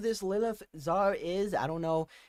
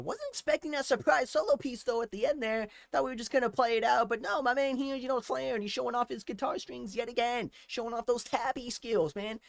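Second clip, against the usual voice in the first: higher, hyped-up praise; cheering on the guitar solo; excited praise; 7 semitones higher than usual. Real, not acted. A fan hyping the guitarist's solo, excited.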